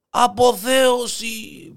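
high, long-drawn triumphant call; crying out. Acted. Hare in the jungle proclaiming his ecstasy, crying out. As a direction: stretch the word out in a high call. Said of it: "Και φωνάζει".

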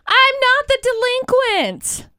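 high, drawn-out protesting cry; indignant protest. Real, not acted. Accused co-host answering the teasing host, indignant protest.

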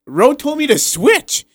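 high, slow, sheepish excuse; whiny player excuse. Retold or imitated. Player excusing himself to his coach, high and sheepish. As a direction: pass the blame like a kid. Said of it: "and he's like".

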